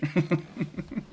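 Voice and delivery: soft high-pitched giggling; quiet amused laughter; giggle. Real, not acted. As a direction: keep chuckling at your bad accent. Laughs at own Irish attempt.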